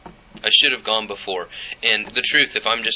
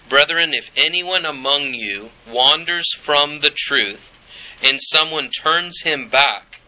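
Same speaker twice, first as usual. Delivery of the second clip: slow, raised, measured reading of scripture; preacher reading the Bible slowly and a little higher; 3 semitones higher, 3 dB louder, slower than usual. Acted. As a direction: read it out like a solemn passage, lifting each phrase. Reads James chapter five aloud.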